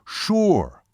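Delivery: high, falling, certain "sure"; imitated Seminole man. Seminole confirms to doubting waitress, short and emphatic. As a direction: confirm it in one emphatic word. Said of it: "even imitating his voice and gestures".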